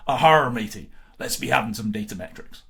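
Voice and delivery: loud, hammy pirate growl. Acted. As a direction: bellow it like a pirate captain. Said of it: "talk like a pirate".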